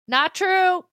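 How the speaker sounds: high, loud "Not true" snapped at her own ego; firm out-loud rebuttal. Shown as a demonstration. Coach telling her inner critic off, high and loud. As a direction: shut the ego down hard, out loud. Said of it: "sometimes I have to say it out loud".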